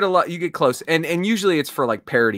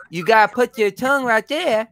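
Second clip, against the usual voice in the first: usual speaking voice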